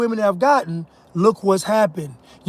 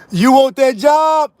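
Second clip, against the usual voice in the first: slow, higher, laughing shout; laughing taunt; 4 semitones higher, 3 dB louder, slower than usual. Real, not acted. Taunting listeners about wanting the job, laughing. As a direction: tease them loudly through laughter.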